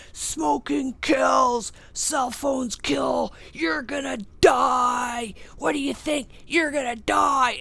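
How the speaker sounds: put-on high, loud, slow chanting kid shout; screaming kids. Schoolkids screaming warnings at a smoking stranger, high loud shout. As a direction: scream it like kids taunting over a fence. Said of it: "they start screaming at me".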